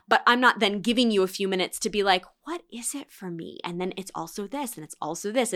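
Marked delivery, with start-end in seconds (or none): 2.4-5.5 hushed, as if thinking to oneself; quiet self-reflection. Acted. Listener asking herself what applies, quietly reflective. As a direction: drop to a soft, musing inner voice.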